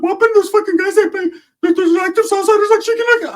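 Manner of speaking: very high, fast cartoonish voice; Ricky. Cousin griping about chicken nuggets, very high put-on voice. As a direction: squeal it out in an exaggerated impression. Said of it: "Ricky's like".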